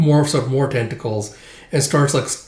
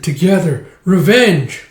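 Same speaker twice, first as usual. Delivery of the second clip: loud, raised ghostly call; clamouring spirits; 5 semitones higher, 6 dB louder, slower than usual. Acted. Spirits calling to the hero, loud clamour. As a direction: call out like vengeful specters. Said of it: "all these specters and spirits".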